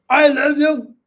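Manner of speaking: loud, high shout of I love you; shouted love declaration. Shown as a demonstration. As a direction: yell it at a sweetheart, impolitely. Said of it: "why is this guy shouting at me".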